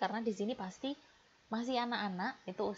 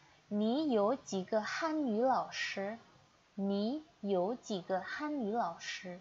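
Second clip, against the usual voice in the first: slow, clear Mandarin, each syllable spaced for repeating; slow model reading; slower than usual. Shown as a demonstration. Teacher modeling for students to repeat, slow and clear. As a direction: read it slowly so students can copy. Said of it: "sambil ikuti Lao She baca".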